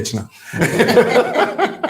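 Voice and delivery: a rising burst of real laughter; hearty laugh. Real, not acted. Radio host laughing at a caller's question. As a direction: laugh out loud, amused.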